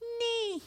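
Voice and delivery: tiny high-pitched squeak of a nee; thin high nose-whistle nee; high whistling nee. Retold or imitated. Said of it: "I hear this".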